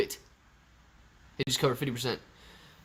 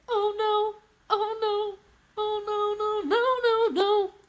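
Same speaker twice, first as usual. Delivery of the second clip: very high falsetto wail; sky-high, drawn-out mock despair; mock dismay; slower than usual. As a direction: squeal a string of oh-nos in a squeaky put-on voice. High put-on mock lament.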